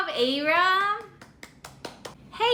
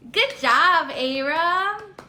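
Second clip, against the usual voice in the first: ordinary delivery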